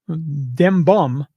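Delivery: normal talking voice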